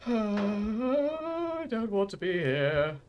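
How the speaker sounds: long, high drawn-out whine; mock wail. Player protesting being stuck in the game, high mock wail. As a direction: wail it slowly, dragging out the first word.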